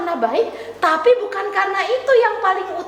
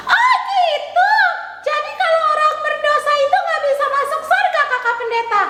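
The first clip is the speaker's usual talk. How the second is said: high, cheery puppet voice; chirpy child puppet saying hello; puppet girl Tiur; 12 semitones higher, faster than usual. Acted. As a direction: greet the kids as the little puppet.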